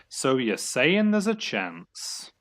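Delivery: drawn-out hopeful movie line; slow hopeful quote. Acted. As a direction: stretch it out, hopeful.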